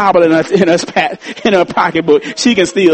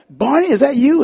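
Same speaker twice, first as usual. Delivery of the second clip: surprised question; 9 semitones higher than usual. Retold or imitated. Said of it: "And Andy's like".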